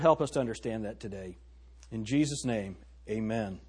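bow your head and pray quietly to the Lord; opening prayer. Acted. Pastor praying before the sermon, soft reverent prayer.

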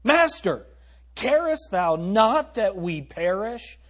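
loud, high, drawn-out plea; pleading cry. Acted. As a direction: call out slowly in alarm to the master. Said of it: "That's what they're saying to Jesus".

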